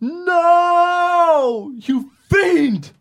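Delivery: loud, high melodramatic wail; hammy anguished cry. Acted. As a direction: overact the betrayal. S1 hammed horror.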